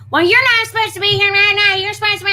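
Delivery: fast, high, loud mock scolding; hammed-up angry lecture; imitated scolding woman. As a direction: rattle off her scolding in a shrill rush.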